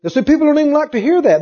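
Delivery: ordinary delivery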